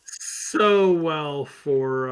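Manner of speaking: slow, dragged-out sarcasm; sarcastic. Real, not acted. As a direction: drawl it out, dripping with irony. Sarcasm about rebranding failures.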